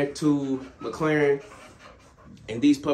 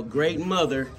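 normal talking voice

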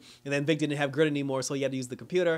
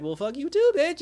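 much higher, louder sassy clapback; sassy retort; 12 semitones higher, 6 dB louder than usual. Retold or imitated. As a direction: snap it back with attitude, playing offended. Everyone glaring at Rita.

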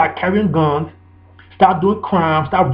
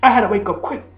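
normal talking voice

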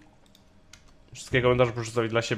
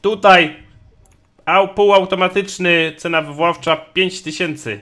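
auctioneer; 5 semitones higher, 9 dB louder than usual. Acted. Announcing next lot.

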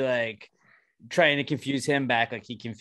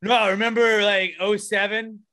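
lightly voiced fan, higher and a bit louder; mock fan; 6 semitones higher, 4 dB louder than usual. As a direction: play a clueless sports fan reminiscing. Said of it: "Let's start doing that, though. Like".

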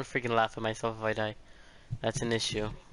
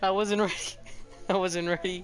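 laughing; 7 semitones higher than usual. Real, not acted. Laughs after dying.

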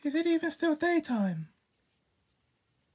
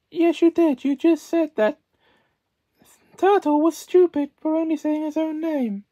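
loud prim scolding; prim schoolmarm; 5 dB louder than usual. Acted. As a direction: scold like a strict governess.

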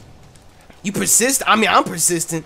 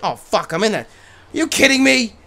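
ordinary delivery

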